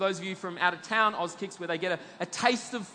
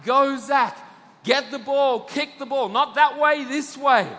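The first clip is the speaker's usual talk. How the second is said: loud, high sideline cheering; dad shouting encouragement to his son on the field, loud; yelling from the sideline; 6 semitones higher, 9 dB louder than usual. Retold or imitated. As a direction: yell like a proud parent at junior footy. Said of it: "I'm yelling out".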